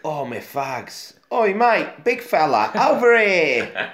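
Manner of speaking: high, loud Cockney shout, stretched out; Cockney cat. Retold or imitated. Cat hailing the ape, loud Cockney voice. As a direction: call across the street like a cheeky Cockney. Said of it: "a Cockney".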